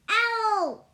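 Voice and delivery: high, loud call of the name. A narrator's reading. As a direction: shout it up the stairs. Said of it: "he cried".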